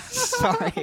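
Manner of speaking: squeaky high giggle; laughing. Real, not acted. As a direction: giggle through the apology. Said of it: "Stop doing that".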